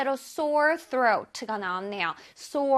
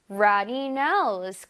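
very slow, careful English; slow teacher demo; slow model reading; 4 semitones lower, much slower than usual. Shown as a demonstration. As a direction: read it out slowly for the class.

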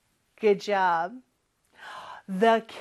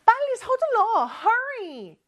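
hurrying mom; 10 semitones higher, 3 dB louder, slower than usual. Retold or imitated. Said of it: "엄마가 또 말씀하시네요".

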